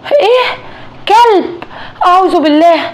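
very high, sharp gasps of disbelief; wife stunned by the dog, crying out high; shocked. Acted. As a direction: jolt up in pitch at each word.

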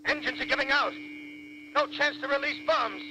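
high, strained voice climbing toward panic; desperate last report. Acted. Bomber pilot radioing base while diving to his death, panicked.

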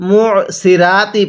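"সিরাতি" with a light s and an open "raa"; thin সিন and রা. Shown as a demonstration. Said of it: "অনেকেই শব্দটাকে". ("সিরাতি"-তে পাতলা সিন, র-কে "রা" উচ্চারণ)

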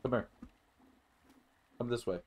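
ordinary delivery

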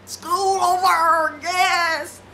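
high, loud, drawn-out school's-out whoop, half sung; excited cheer. Retold or imitated. Celebrating the end of the school week, loud happy whoop. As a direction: whoop it up like the weekend came early. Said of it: "I'm like".

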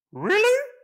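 very high, loud astonished warthog; squealing surprise. Acted. The warthog hears sixteen, very high and astonished. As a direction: squeal in disbelief.

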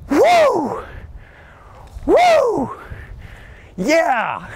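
real delighted woo-hoo; excited whoop. Real, not acted. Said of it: "Get excited".

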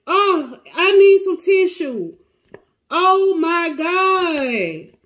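high, drawn-out near-crying; tearful wailing. Real, not acted. Reader overcome by Tori's energy, tearful wail. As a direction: wail as if about to break down. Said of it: "I really wanna cry".

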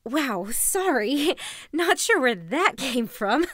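nervous laugh breaking into the apology; embarrassed laughing. Acted. Vlogger brushing off her own dark confession, embarrassed laughing.

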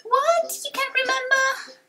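very high, incredulous squeak; dismayed young hero. Acted. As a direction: cry out high in disbelief.

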